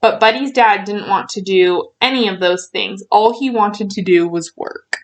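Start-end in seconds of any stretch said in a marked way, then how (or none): none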